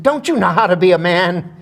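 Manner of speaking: loud, high mock scolding; Helen Hayes. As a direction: berate him as an outraged actress. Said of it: "she said".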